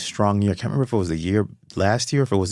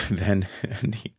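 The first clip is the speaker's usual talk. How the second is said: real laughter breaking into the words; laughing; 4 dB quieter than usual. Real, not acted. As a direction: chuckle through it, remembering a joke. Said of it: "I'm laughing because".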